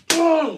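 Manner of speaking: explosive, high, loud boom; imitated impact boom. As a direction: shout the sound of a smashing blow. Imitates a blow landing.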